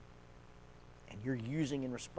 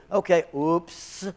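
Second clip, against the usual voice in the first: slow, sly put-on oops; mock-innocent oops; 5 semitones higher, 12 dB louder, much slower than usual. Counselor showing passive aggression, slow sly oops. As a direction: drag it out, faux-innocent. Passively not obeying, pen demo.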